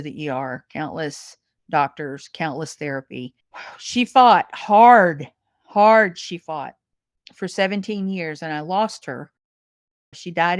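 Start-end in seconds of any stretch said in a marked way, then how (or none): 3.8-6.8 she fought hard, each word leaned on hard, slow and loud; slow, loud, deliberate emphasis; emphatic, slow. Real, not acted. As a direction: press every word like a vow. Grieving mother stressing daughter's fight.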